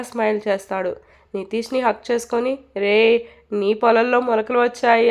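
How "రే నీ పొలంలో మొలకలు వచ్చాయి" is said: usual speaking voice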